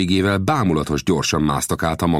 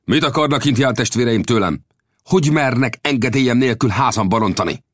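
higher, sharp scolding; angry rebuke; 7 semitones higher than usual. A narrator's reading. The caballero angrily rebukes the Comanche warriors. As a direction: snap at the intruders. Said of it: "haragosan rájuk csördített".

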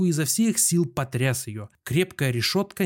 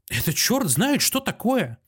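a sharp cry rising much higher; sudden outcry; 7 semitones higher than usual. A narrator's reading. Patient jumps up, outraged at the locked door, crying out. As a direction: burst out in outrage. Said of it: "вскрикнул вдруг Иван Дмитриевич".

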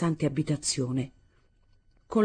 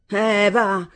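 louder, higher haughty dismissal; contempt and haughtiness; 4 semitones higher, 6 dB louder than usual. A narrator's reading. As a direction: brush her off with disdain. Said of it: "dissi con disprezzo e alterigia".

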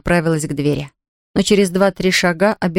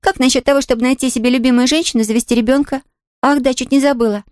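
put-on lightly higher young girl voice; a lively little girl; 7 semitones higher than usual. A narrator's reading. Pollyanna voiced by reader.

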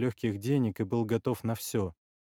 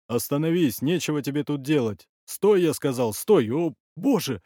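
loud orders rising to alarm; alarmed warning; 4 semitones higher, 7 dB louder than usual. Acted. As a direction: shout to halt whoever is coming at you. Ordering approaching attacker to stop.

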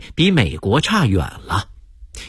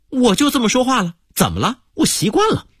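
sulky retort; 9 semitones higher, faster than usual. Retold or imitated. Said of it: "服务员一脸不高兴".

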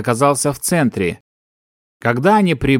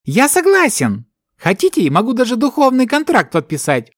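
high, warped agreeable voice; distorted voice; 10 semitones higher than usual. A narrator's reading. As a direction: agree lightly in an unearthly voice. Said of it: "искажённым голосом".

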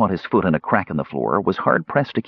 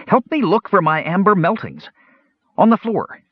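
high kender voice; 11 semitones higher than usual. A narrator's reading.

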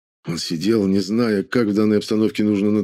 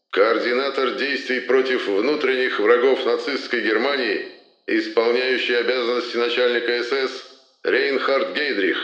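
booming solemn state announcer; 3 semitones higher than usual. Acted. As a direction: read it like a Soviet state broadcast. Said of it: "глубокий торжественный голос Юрия Борисовича Левитана".

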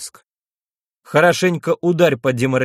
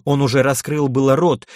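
usual speaking voice

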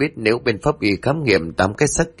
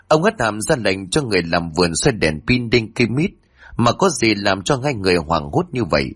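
plain reading voice

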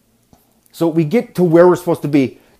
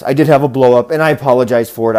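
normal talking voice

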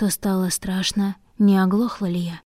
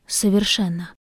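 ordinary delivery, nothing marked

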